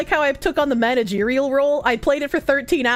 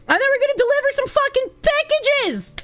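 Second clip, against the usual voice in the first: hyped up; 9 semitones higher than usual. Real, not acted. Hyped announcement of the game.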